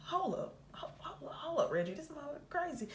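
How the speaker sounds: very soft, stammering near whisper; hushed, rattled disbelief; alarmed hushed aside. Retold or imitated. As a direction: whisper a startled warning into the phone. Retold alarm to friend on phone.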